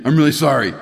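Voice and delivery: a kid's forced, exaggerated "I'm really sorry"; exaggerated apology. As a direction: ham up the apology like a kid told twice. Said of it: "Say it like you really mean it".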